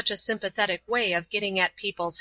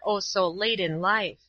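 ordinary delivery

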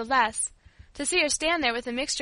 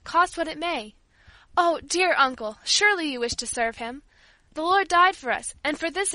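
high, loud, fervent entreaty; impassioned child's appeal, swelling and slowing; desperate pleading; 5 semitones higher, 5 dB louder than usual. A narrator's reading. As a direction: beg with all your heart. Paula begs her uncle tearfully.

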